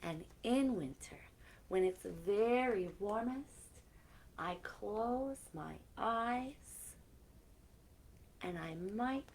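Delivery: gentle storybook reading with soft whispered lines; reading to children. Acted. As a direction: read it like a bedtime story to kids. Reading a picture book aloud.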